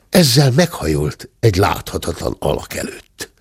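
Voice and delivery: ordinary delivery, nothing marked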